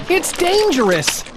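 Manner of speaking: slow, high plea; pleading. Acted. Bartholomew urges the captain, mild pleading. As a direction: beg him to listen. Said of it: "pleaded Bartholomew".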